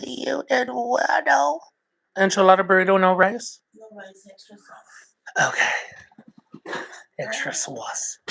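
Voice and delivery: a deep-voiced mom asking her kid for Taco Bell orders. Low mama voice taking the Taco Bell order.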